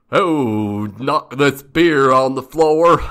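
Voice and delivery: loud, slow growly stumblebum; hammed-up growling complaint; grunting, growling janitor. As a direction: bellow it out like a grumbling oaf. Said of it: "he's grunting and growling".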